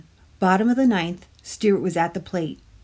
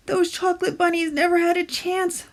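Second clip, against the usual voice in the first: moaning Stuart; 11 semitones higher than usual.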